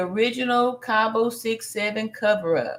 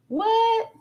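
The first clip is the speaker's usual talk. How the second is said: high-pitched, startled question; shocked disbelief; 12 semitones higher than usual. Real, not acted. Host reads that the squirrels were rats, shocked disbelief. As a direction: squeak it out in disbelief.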